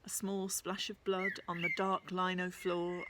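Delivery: usual speaking voice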